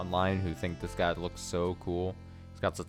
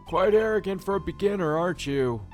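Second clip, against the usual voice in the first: higher and louder judge; 9 semitones higher, 6 dB louder than usual. Acted. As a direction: scold him like a judge. Judge scolding.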